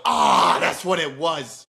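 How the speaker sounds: very loud drawn-out cry of mock revelation; shouted realization. Newcomer in heaven learns the reason, hammed-up loud shout. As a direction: burst out loudly as if it finally dawns on you.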